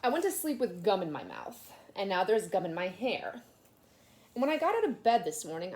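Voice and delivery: put-on lively read-aloud to children; storybook reading. A narrator's reading. Teacher reading a picture book aloud to her class, animated. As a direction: tell it like a kid's picture book.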